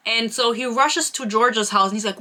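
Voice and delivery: ordinary talk, nothing marked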